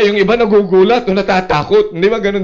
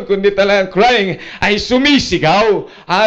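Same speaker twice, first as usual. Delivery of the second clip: ordinary delivery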